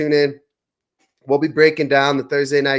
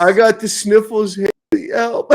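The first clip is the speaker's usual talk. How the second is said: high whiny cry for help; man flu whine; 6 semitones higher than usual. Retold or imitated. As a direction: whimper like a helpless sick man. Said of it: "the man flu is real".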